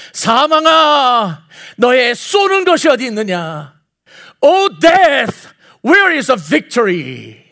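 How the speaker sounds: loud, drawn-out defiant cry; bold challenge. Retold or imitated. Paul confronting death in Corinthians, boldly challenging. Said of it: "당당하게 따지는 거예요".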